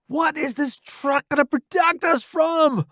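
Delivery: high, strained yell; incredulous shout. A narrator's reading. Newbie doubting the truck as cover, incredulous shout. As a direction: raise the voice over the bombs. Soldier asks under falling bombs.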